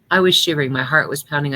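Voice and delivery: usual speaking voice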